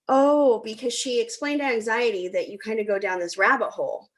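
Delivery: ordinary talk, nothing marked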